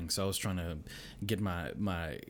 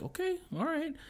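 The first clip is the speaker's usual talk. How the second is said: much higher mock resigned voice; giving in. Dad to stubborn kids, mock resigned. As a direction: give in, sing-song. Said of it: "I'm like".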